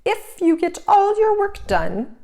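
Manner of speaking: slyly. A narrator's reading. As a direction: lay the trap sweetly and slowly. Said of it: "she said slyly".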